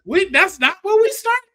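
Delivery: high, excited fan voice; mock confused fan. Host playing a baffled fan, high pitched. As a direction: squeak out the question in disbelief. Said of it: "that's why I'm just like".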